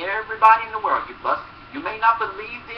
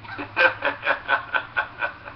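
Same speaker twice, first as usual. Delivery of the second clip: breathy laugh; 5 semitones lower, 3 dB quieter than usual. Real, not acted. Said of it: "laughs at own parody".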